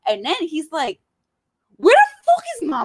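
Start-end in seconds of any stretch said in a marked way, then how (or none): 1.8-2.8 shrill panicked demand; mock panicked yell from a confused friend; high yelling quote. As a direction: pitch up and shout it confused.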